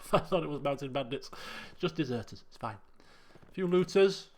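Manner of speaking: ordinary talk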